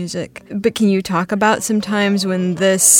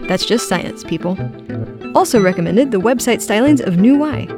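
chipper 1950s ad; 3 semitones higher, 4 dB louder, faster than usual. Acted. Mock nutrition-label podcast ad.

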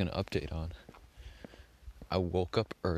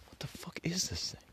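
a real, tense whispered curse; frightened whisper; 11 semitones higher, 5 dB quieter than usual. Real, not acted. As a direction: breathe it out, scared of the creature. Spooked by a dark shape on road.

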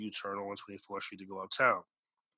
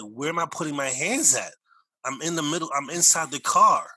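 raised, increasingly loud panicked protest; retold freak-out; 4 semitones higher, 8 dB louder than usual. Boy in the middle seat answering armed police, retold freak-out. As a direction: protest in alarm, building to a loud last line. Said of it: "I'm freaking out".

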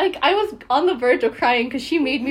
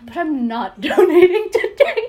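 laughing through the quote; 4 semitones higher, 7 dB louder than usual. Retold or imitated. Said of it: "She's like".

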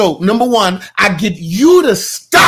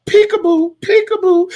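mock peekaboo; 13 semitones higher than usual. Teasing congregation about peeking.

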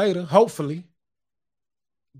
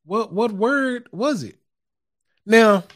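ordinary delivery

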